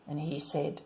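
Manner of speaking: ordinary delivery, nothing marked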